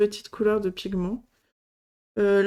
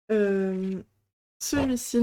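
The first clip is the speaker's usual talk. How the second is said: ordinary talk, nothing marked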